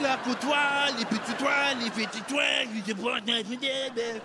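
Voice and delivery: high, long-winded gibberish French announcement; mock French announcer. French rocket attack announcement that takes too long, high-pitched mock French. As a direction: rattle on in exaggerated fake French, far too long. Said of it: "Could have been in French".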